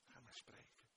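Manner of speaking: whispering the plea; whispered prayer. Acted. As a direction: breathe the words as a prayer. (gefluisterd gebed)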